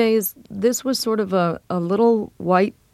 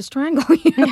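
higher-pitched retold threat dissolving into laughter; laughing mock threat; 9 semitones higher, 4 dB louder than usual. Retelling a fed-up man's threat over a repeated song, breaking into laughter. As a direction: crack up on the last word of the threat.

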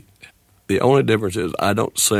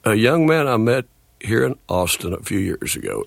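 ordinary talk, nothing marked

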